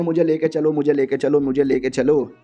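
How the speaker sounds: ordinary delivery, nothing marked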